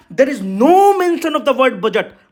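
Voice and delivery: "there is no mention" pitched high and stretched out slowly; emphatic stress. Real, not acted. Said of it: "what I wanted to emphasize". ("no mention" पर धीमा, ऊँचा ज़ोर)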